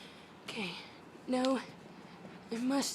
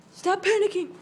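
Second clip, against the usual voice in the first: louder firm plea; 4 semitones higher, 7 dB louder than usual. Real, not acted. Firmly pleading with the panicking bird. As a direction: tell it firmly to stop panicking.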